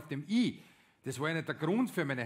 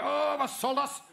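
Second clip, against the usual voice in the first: viel höher, gesanglich; Schlagersänger-Stimme; 12 semitones higher, 5 dB louder, slower than usual. Acted. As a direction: wie ein Schlagerstar trällern. Singt wie ein deutscher Interpret. (hohe Sängerstimme eines Schlagerinterpreten)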